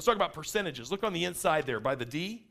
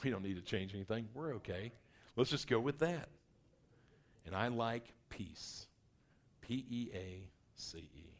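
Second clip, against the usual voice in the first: soft and slow, easing every word out; unhurried, lower, content to keep things as they are; soft slow peace-loving voice; calm steady type; 4 semitones lower, 6 dB quieter than usual. Acted.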